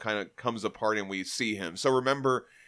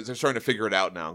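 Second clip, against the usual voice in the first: ordinary delivery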